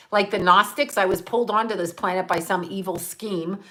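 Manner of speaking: ordinary delivery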